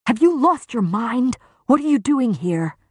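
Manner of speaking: high-pitched, urgent hissed reproach; hissed scolding. A narrator's reading. Lucien to the injured prisoner in her cell, hissed scolding. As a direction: scold in an agitated hiss, pitch jumping up. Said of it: "He hissed".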